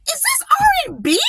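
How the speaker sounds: can't believe the genre; incredulous. Retold or imitated. Baffled reaction to song.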